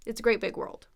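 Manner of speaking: quick, run-together "great big world"; said fast. Shown as a demonstration. Teacher gives the sentence at natural speed, fast. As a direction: say it fast, words blending into one. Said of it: "saying it that fast".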